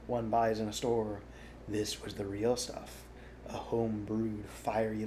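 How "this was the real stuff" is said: ordinary delivery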